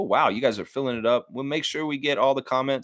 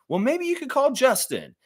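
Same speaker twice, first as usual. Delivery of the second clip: teasing ad pitch; 13 semitones higher than usual. Pretend. Mock ad, teasing Justin.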